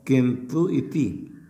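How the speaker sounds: किंतु इति drawn out slowly, syllable by syllable; slow demo. Shown as a demonstration. Teacher slowly showing students how the words join. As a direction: spell out किंतु इति slowly so students hear each part. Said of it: "teacher shows the sandhi slowly".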